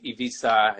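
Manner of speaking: Ibiza as "ee-VEE-sa"; Catalan pronunciation. Shown as a demonstration. Guest gives the island's Catalan name, Ibiza with an s. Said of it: "in Catalan".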